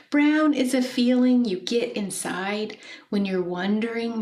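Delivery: gently expressive poem recital; poem reading. Acted. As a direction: read the poem to the class, savouring each line. Teacher reads poem aloud.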